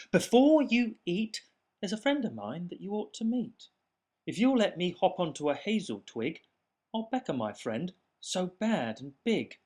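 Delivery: high, fast, persuasive mouse; quick talking mouse. Acted. Mouse talking his way out of being eaten, fast and high. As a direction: talk fast to save your skin. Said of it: "said the mouse".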